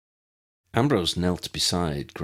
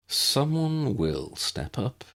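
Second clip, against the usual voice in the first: confirm; 8 semitones higher, slower than usual. Acted. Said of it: "Lucius confirmed".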